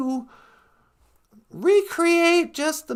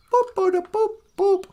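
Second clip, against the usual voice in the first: computer boops; 6 semitones higher, 3 dB louder than usual. Pretend. Imitating computer beeps.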